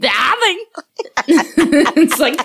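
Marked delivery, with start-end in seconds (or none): 0.0-0.6 put-on higher kid voice, DADDY with blurred d's; toddler mispronunciation. Parent imitating son, higher lisped DADDY. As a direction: call DADDY like a lisping child. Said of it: "That's how he says daddy".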